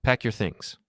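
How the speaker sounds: ordinary delivery, nothing marked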